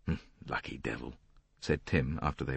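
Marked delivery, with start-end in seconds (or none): 0.1-1.2 much softer, low grumble; muttered. A narrator's reading. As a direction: mutter it under your breath. Tim mutters after couple passes.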